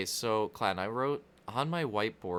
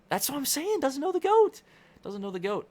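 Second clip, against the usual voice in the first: mock outrage; 5 dB louder than usual. Mock outrage about mom.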